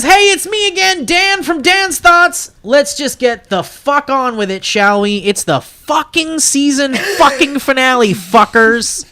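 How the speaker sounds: high-pitched, hyped message read-out. Acted. Host reading Dan's message to the room, hyped read-out.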